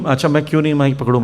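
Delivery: ordinary delivery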